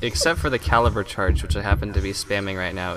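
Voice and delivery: normal talking voice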